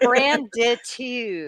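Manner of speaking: "Branditude" stretched out slowly, syllable by syllable; drawn-out announcement. Shown as a demonstration. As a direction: draw out each syllable of "Branditude". Host repeats new word for comments.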